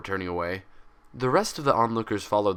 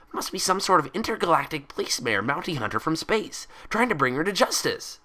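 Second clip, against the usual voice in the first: high, loud, breathless excitement; excited theorizing; 8 semitones higher, 4 dB louder than usual. Acted. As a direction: spin the theory thrilled. Pinkie wild theory.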